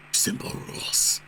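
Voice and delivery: soft unvoiced whisper. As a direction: breathe it out as a whisper. Reads rules line twice.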